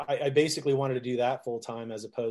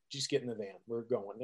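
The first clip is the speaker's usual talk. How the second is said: soft, flat, unbothered; calm big brother shutting it down; stoic brother; 9 dB quieter than usual. Retold or imitated. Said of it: "stoic six-foot-four".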